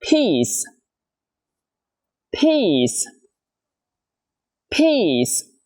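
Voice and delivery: "Peace" with a tense ee and a hissed s; model word. Shown as a demonstration. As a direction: spread lips, puff on P, clear s. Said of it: "Từ đầu tiên các bạn".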